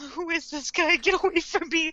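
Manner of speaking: high, mildly alarmed retelling; mock fear. Light shrinking from the creepy stranger, mock fear. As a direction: recoil in imitated unease. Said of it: "I could just feel Light's fear".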